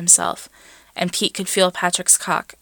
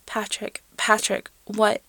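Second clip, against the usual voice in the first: strained, just managing; 4 semitones higher than usual. A narrator's reading. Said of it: "he managed".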